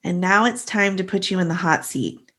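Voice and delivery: ordinary talk, nothing marked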